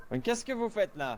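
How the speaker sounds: loud laugh, then slow laughing words. Real, not acted. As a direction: laugh through the question. Burst of laughter, then question.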